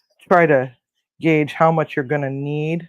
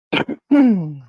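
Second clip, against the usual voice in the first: loud rough throat clear; 6 semitones higher, 8 dB louder than usual. Real, not acted. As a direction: clear the throat hard, choking a bit. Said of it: "choking on my, uh, choking on my allergy".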